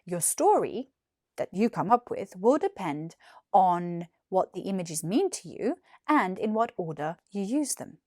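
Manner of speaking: ordinary delivery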